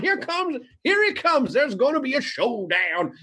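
put-on very high, excitable old prospector. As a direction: cry out like a hammed-up Western old-timer. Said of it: "It's the old prospector".

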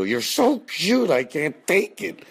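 high slow squeal of adoration; gushing over a cute kid. Retold or imitated. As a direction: coo at the kid like you can't stand the cuteness. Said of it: "I'd wanna go".

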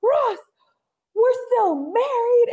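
high, slow calling out to Ross. Retold or imitated. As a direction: call him from the doorway, drawn out high. Rachel storms in, yelling.

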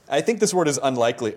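ordinary delivery

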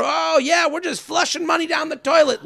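high, careless snob voice; entitled private school drawl. Pretend. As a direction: sneer it like a pampered rich boy. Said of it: "Richie Rich, who doesn't care".

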